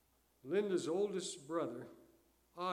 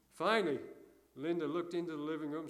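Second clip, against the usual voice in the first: ordinary delivery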